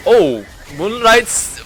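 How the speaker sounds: loud, high play-by-play; hyped commentary. Real, not acted. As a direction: call the play with rising hype. Caster hype call.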